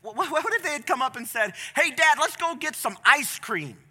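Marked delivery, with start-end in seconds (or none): 1.7-3.8 put-on higher, louder demanding child; whiny kid. Child asks father about dinner, whiny kid voice. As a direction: play a kid pestering dad.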